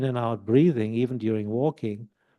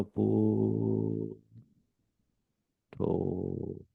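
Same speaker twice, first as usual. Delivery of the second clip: very slow and much softer, boo and to stretched long; dragged mantra; 2 semitones lower, 8 dB quieter than usual. Shown as a demonstration. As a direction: draw each syllable out for a whole breath. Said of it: "drag the first and the second syllable".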